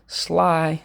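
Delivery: "sly" said alone and high; first syllable sly. Shown as a demonstration. Teacher isolating the first syllable of slimy. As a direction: pitch up on "sly". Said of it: "First syllable".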